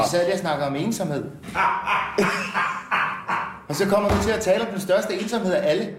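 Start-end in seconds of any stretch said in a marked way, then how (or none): 1.3-3.6 long, breathless laughing fit; real drawn-out laughter. Real, not acted. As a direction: laugh helplessly with little voice. Laughing about the chat derailing.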